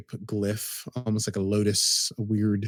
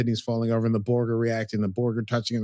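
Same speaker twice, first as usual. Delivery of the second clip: normal talking voice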